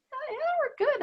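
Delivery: put-on very high little-kid reply; child's reassurance. Inner child answers her check-in, high-pitched reassurance.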